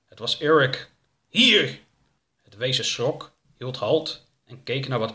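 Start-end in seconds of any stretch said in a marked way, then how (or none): 1.3-1.8 loud, high shout; high, powerful call; forceful command. Acted. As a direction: shout it at the monster. Eric confronts the creature.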